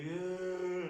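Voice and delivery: loud, high crying noise; hammed-up wail of a crying kid; mock child's wail. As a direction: imitate a kid bawling. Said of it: "this kid was crying".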